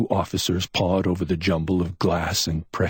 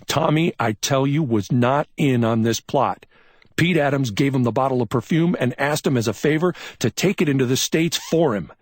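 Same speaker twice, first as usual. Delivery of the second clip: higher, forceful, pressing; insistent detective; 9 semitones higher, 4 dB louder than usual. A narrator's reading. McMain shakes Chuck while explaining, insistent. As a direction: drive the facts home.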